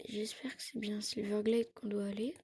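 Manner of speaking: whispered unsure aside; whispering. Real, not acted. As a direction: murmur it in a whisper.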